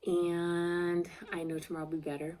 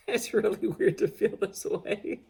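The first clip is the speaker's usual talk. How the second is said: crying through it; 4 semitones higher, 5 dB louder than usual. Real, not acted. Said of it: "I'm gonna frickin' cry again".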